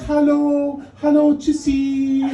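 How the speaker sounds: high, drawn-out singsong German greetings; sweet Berlin German. Retold or imitated. Berliners greeting each other, sugary singsong German. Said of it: "now we're in Berlin and everybody's".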